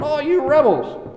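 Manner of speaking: high, exasperated cry of rebels; angry Moses. Retold or imitated. Preacher voicing Moses angrily rebuking the rebels, high and sharp. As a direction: Moses flaring up at the people, pitched high. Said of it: "Moses was angry".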